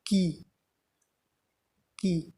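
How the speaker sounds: teacher pronouncing the new syllable for the class; demo syllable. Shown as a demonstration. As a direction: sound out की with a long "ee" vowel. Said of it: "अब ये क्या बन जाएगा".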